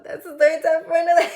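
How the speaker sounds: high, loud, quick and playful; high playful repeat. Real, not acted. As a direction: rush it up high, about to laugh. Repeats the aside, breaking into laughter.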